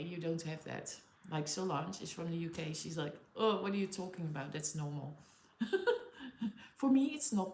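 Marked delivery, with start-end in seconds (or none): none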